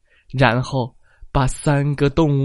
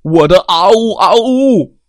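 loud, higher, fast bear growl; 7 semitones higher, 6 dB louder than usual. Acted. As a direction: roar your boast at the others. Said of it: "熊咆哮道".

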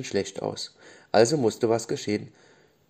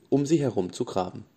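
usual speaking voice

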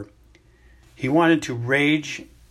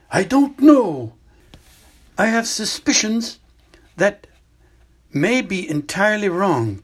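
put-on higher, louder elderly wizard voice, calm and grave; an old headmaster explaining gently but seriously; Dumbledore; 8 semitones higher, 6 dB louder than usual. Headmaster tells student about the hidden cave, grave and measured.

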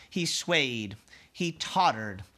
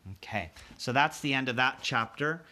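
usual speaking voice

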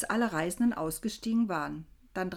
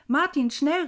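loud, high urgent call; dringendes Rufen; 7 semitones higher, 7 dB louder than usual. A narrator's reading. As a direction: call out to hurry him along. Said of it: "rief ich". (lauter, dringender Ruf)

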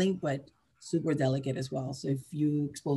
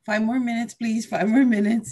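higher, louder mock plea; sleepy body pleading; 7 semitones higher, 6 dB louder than usual. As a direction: beg for a few more minutes, pitched up. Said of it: "So your body's still like".

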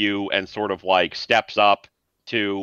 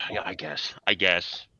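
reluctant grumble; 3 semitones lower, 7 dB quieter than usual. Acted. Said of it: "scratches his chin and goes".

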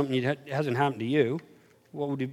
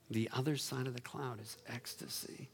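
soft echo; 5 semitones lower, 19 dB quieter than usual. Shown as a demonstration. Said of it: "quiet repeat of line 36".

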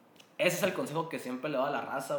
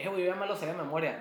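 rushed; 4 semitones higher, much faster than usual. Real, not acted. Rushing, excited.

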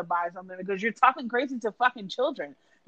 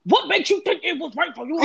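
angry mom; 7 semitones higher, 4 dB louder than usual. Retold or imitated. Mother confronting the clerk.

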